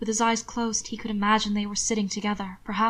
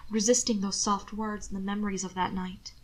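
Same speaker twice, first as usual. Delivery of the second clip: plain reading voice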